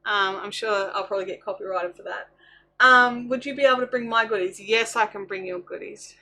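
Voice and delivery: ordinary delivery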